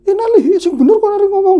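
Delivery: high, loud angry scolding; angry mother. Retold or imitated. Mother scolding her daughter for the news, high loud angry retort. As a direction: snap at her in disbelief. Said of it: "marah-marah kan".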